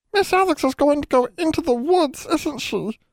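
very high, squeaky worried elf voice; anxious house elf. Acted. House elf Deezy wringing hands over Alex going into woods. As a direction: fret it out like a tiny worried elf.

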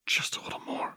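breathy whisper, barely voiced; whispered plea. A narrator's reading. Whispered plea to Jinx to hold on. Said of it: "whispered plea".

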